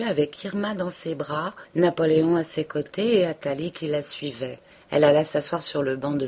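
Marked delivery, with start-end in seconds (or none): none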